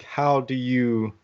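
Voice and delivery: normal talking voice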